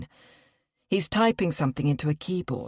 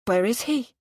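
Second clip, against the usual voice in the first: short, sharp, higher question; anxious mother, a bit too curt with the receptionist; a little too curtly; 7 semitones higher than usual. Acted. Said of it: "a little too curtly".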